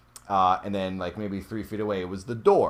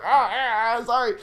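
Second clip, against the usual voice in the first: sheepish apology; 6 dB louder than usual. Retold or imitated. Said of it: "I was like".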